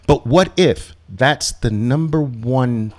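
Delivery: ordinary talk, nothing marked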